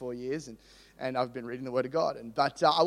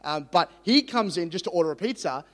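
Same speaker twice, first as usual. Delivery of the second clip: ordinary talk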